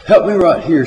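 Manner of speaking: loud call for a hand. Acted. John calls for help lifting.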